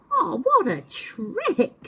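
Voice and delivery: high, slow squeal of glee; tickled squirrel. Acted. Squirrel squeals at the trick, high and slow. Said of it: "squealed the squirrel".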